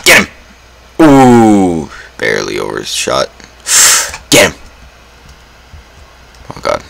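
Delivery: normal talking voice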